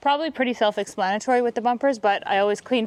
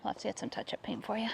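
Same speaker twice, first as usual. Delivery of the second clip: whispering; 13 dB quieter, faster than usual. Real, not acted. Cooing to the damaged car.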